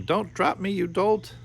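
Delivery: put-on high squeal of a tiny boy; squealing tiny brother. A narrator's reading. Tiny Yorick clinging to Charles's thumb, squealing high. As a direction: squeal it, scared of falling.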